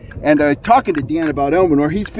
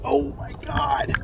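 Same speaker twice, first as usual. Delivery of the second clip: breathless whisper, pitched up in amazement; awed whisper; 10 semitones higher, 9 dB quieter, slower than usual. Real, not acted. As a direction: gasp it out under your breath, stunned. Huge fish nearing the net.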